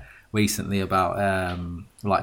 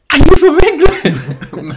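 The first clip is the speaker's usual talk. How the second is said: very high, loud, slow squeal; mock amazed girls; 13 dB louder, slower than usual. Vegas girl bumped into by a Brit, mock squeal of amazement. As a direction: gush like a thrilled American girl, hammed up.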